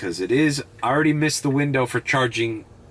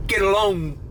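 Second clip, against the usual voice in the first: high imitation of a British goodbye; mock British accent; 11 semitones higher than usual. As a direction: put on a quick London accent. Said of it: "just that British accent".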